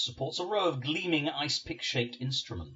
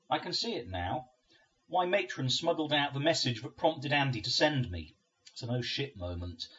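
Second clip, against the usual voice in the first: ordinary delivery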